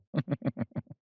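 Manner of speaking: quick, pulsing little chuckle; mocking chuckle. Teasing about old fuddy-duddy Elias to a darling, mocking chuckle. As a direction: chuckle at someone else's expense.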